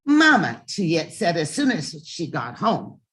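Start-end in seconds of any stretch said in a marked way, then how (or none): 0.0-0.6 loud, high call of Mama; eager child calling Mama. Acted. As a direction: call to her mother from the door. Child calling mother on arrival.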